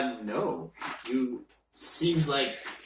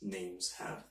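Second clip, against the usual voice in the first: ominous whisper; 6 semitones lower, 13 dB quieter than usual. Acted. Entity repeats low.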